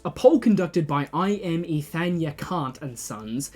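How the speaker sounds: ordinary talk, nothing marked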